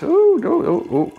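high excited ooh sounds; excited squeals. Real, not acted. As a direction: squeal as it gushes out. Mead pouring out fast.

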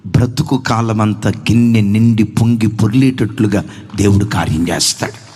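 lightly voiced kind old man; gentle counsel. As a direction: speak low like a kindly old man comforting a boy.

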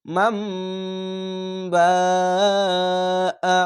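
"মাম বা আ", long hummed m, very long বা, short আ; four-alif stretch. Shown as a demonstration. Teacher recites the example for learners, hugely drawn out. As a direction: hum the m, stretch বা far, cut off on আ. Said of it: "চার আলিফ টেনে পড়বেন".

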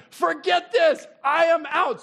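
high, loud, fed-up shout; loud quitting. Retold or imitated. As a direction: storm off in mock frustration. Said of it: "He's like".

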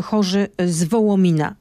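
normal talking voice

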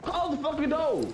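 high, slow mock mama yelling to close the door; imitating his mama. Friend imitates his mother sending him to his room, high and slow. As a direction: play the fed-up mom ordering a kid to his room, drawn out and high. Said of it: "what your mama said".

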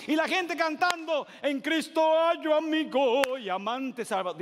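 high, loud rising sermon build; fiery preaching. Acted. Pastor tells the Zacchaeus story to the church, fiery.

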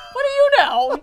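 mock indignant.

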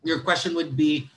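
ordinary delivery

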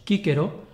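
quíquero with both consonants as hard K. Shown as a demonstration. Teacher showing classical Latin reading, hard C in quíquero. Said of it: "la C se lee siempre como K".

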